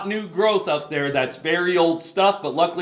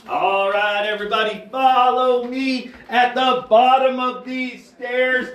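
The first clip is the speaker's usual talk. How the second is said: calling out to group; 6 semitones higher, 5 dB louder than usual. Real, not acted. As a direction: project to the whole group. Guide calling group in cave.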